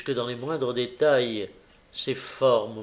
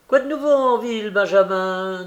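high, loud, quick question; chatty countryman character, pitched up; curious gamekeeper; 8 semitones higher, 6 dB louder than usual. A narrator's reading. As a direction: call up for the news. Gamekeeper asks for news.

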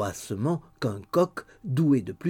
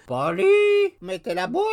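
high croaking bird voice; raven's cry; 7 dB louder than usual. A narrator's reading. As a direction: call it out like a raven. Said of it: "cria Grip".

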